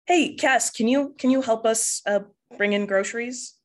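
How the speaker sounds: dad calling out. Acted. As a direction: call over to your kid for a hand. Dad voiced, calling to his kid.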